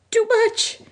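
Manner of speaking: very high, overwhelmed squeal; puffed protest. A narrator's reading. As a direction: act overwhelmed and out of breath. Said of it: "he puffed".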